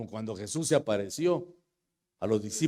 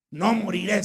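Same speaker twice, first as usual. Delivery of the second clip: higher, louder declaration; shouting prayer; 8 semitones higher, 9 dB louder than usual. Retold or imitated. Sick man declaring in his hospital bed, shouting. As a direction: cry it out against death. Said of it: "Y comencé a gritar".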